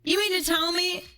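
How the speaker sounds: ordinary talk, nothing marked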